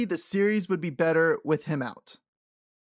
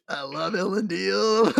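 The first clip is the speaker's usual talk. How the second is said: fond gush; 3 semitones higher than usual. Retold or imitated. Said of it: "I'm just like".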